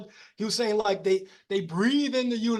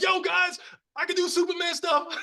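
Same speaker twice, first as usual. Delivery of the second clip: excited bragging shout; 8 semitones higher than usual. Retold or imitated.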